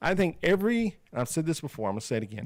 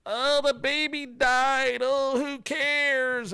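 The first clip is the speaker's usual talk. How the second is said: high, very slow mock child voice; mocking little kid; 11 semitones higher, much slower than usual. Mimicking a kid who shrugs off the baby's death. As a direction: drag it out like a careless kid. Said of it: "some little kid goes".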